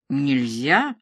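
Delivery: low, firm no to a puppy; как упрямому щенку. A narrator's reading. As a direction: scold like a stubborn puppy's owner. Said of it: "таким тоном, словно обращался к упрямому щенку". (строго, как щенку)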